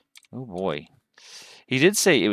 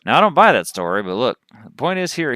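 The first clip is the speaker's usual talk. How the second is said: lively Southern cowboy voice, pitched up; cowboy accent; 4 semitones higher, 7 dB louder than usual. Acted. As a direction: play a cowboy storyteller with a Texas accent. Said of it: "that's the accent I chose".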